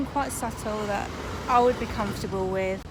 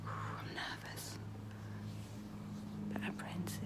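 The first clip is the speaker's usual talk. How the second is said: hushed whisper in a waiting room; 9 dB quieter than usual. Real, not acted. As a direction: whisper so no one hears. Waiting room, quietly to camera.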